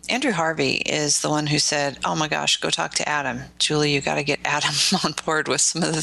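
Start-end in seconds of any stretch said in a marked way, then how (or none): none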